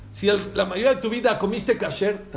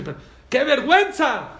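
scolding rabbi; 6 semitones higher, 4 dB louder than usual. Retold or imitated. Students scold their rabbi.

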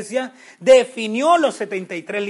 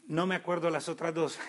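laughing; 5 semitones lower, 13 dB quieter than usual. Real, not acted. Forgets the consonants, laughs.

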